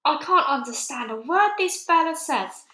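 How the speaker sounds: high, loud irritated snap; snapped. A narrator's reading. Army chief snapping about the BFG, high and loud. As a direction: bite off the words. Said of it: "the head of the army snapped".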